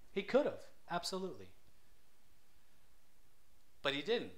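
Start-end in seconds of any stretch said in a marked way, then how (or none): none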